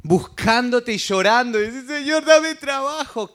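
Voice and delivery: pleading, voice rising and near tears, slowing at the end; acted crying plea to God; tearful prayer. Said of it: "Qué bueno que estás llorando".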